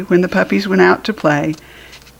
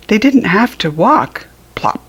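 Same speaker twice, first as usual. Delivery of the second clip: ordinary delivery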